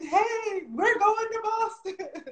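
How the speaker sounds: high, teary, thrilled; overjoyed announcement. Retold or imitated. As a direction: announce it like a dream come true. Calling lady with happy news.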